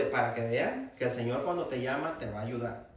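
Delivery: ordinary talk, nothing marked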